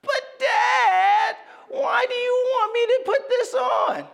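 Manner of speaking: put-on high, loud, wailing little boy; crying child's outburst. Son melting down at dad over long johns, high wailing shout. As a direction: wail it like a toddler in a meltdown.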